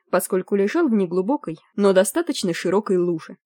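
usual speaking voice

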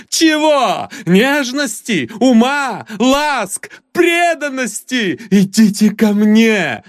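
put-on solemn drunken plea to the girl. A narrator's reading. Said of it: "торжественно сказал".

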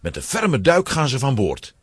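normal talking voice

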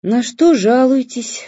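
slow, weary doctor's question; tired doctor. Acted. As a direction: ask it wearily, dragging. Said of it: "устало спрашивала врач".

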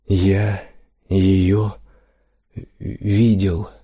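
halting, word by word, long pauses; detached. A narrator's reading. Trembling merchant addresses no one, detached and halting. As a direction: speak as if to nobody, haltingly. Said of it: "отрешённо проговорил он".

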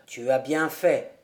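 put-on low, steady voice of a mysterious old charcoal burner; charcoal burner Rouan. A narrator's reading. Charcoal burner welcoming Jude into his hut, low and knowing.

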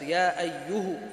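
يا أيه said slowly, ending on a silent "h"; measured recitation that stops on the sukun; slow silent-ha pause. Shown as a demonstration. Teacher reciting the variant readings slowly for students. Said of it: "فيقرأ هذه الألفاظ".

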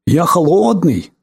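slow, lightly voiced ice. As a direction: play the ice as a toy. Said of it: "Ответил голосом льда". (голос льда)

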